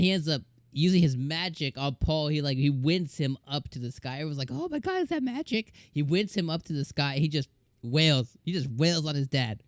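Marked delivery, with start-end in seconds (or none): 4.5-5.7 squeaky high amazed voice; high surprised gasp. Retold or imitated. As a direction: gasp it out in awe. Retold awe at the fight.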